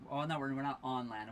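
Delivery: ordinary talk, nothing marked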